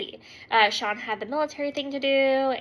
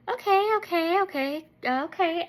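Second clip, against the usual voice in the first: nervous okays; 6 semitones higher than usual. Retold or imitated. Acting herself trying to calm shrieking daughter.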